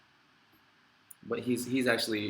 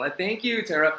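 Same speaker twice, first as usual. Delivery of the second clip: excited thanks; 7 semitones higher, 6 dB louder than usual. Real, not acted. Moved thanks to a viewer.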